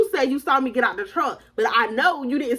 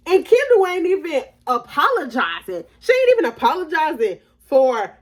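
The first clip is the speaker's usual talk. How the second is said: indignant; 5 semitones higher, 3 dB louder than usual. Real, not acted. Indignant about friend.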